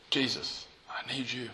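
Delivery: soft, hushed plea; humble prayer. Acted. Preacher models whispered plea to Jesus, soft and humble. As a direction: breathe it out quietly.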